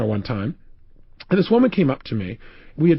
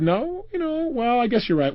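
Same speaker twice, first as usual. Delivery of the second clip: high, softer, wavering into agreement; reluctant concession; 7 semitones higher, 3 dB quieter than usual. Retold or imitated. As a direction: imitate a woman backing down hesitantly. Voices woman reluctantly conceding.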